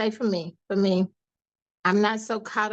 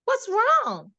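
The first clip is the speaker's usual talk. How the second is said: high-pitched, concerned mom imitation; much higher motherly question; imitating mother; 12 semitones higher than usual. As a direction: lightly voice a mother asking, pitched way up. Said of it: "She goes".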